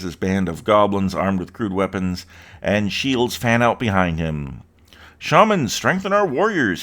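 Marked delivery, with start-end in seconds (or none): 5.2-6.8 high, loud battle command; rally the shamans with a shout. A narrator's reading. Goblin leader commands his shamans, shouting. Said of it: "he shouts".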